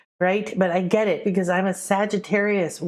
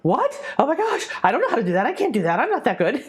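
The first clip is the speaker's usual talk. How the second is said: higher, rushed startled protest; flustered shock; 5 semitones higher, faster than usual. Retold or imitated. Apprentice put on the spot by her teacher, startled and overwhelmed. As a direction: blurt it out in a panic. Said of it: "And I was like".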